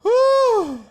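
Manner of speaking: loud, high exhale of excitement; excited high whew. Real, not acted. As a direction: blow out a big excited whew. Streamer exclaims after big moment.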